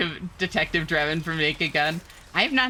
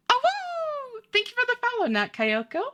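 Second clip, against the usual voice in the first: put-on very high, sugary anime-girl greeting; cute high streamer voice. Streamer greets new followers in a high cutesy voice. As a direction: squeak the thanks way up in a cutesy tone.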